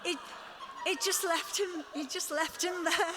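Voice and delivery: high, loud incredulous exclamation; amused disbelief. Real, not acted. Guest recalling a drummer left in the car, loud amused disbelief. Amazed at friend left waiting.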